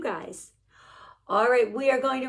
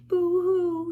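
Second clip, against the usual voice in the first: mock boo-hoo crying; 7 semitones higher than usual. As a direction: play-act a baby's sob for kids. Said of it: "Baby llama starts boo-hooing".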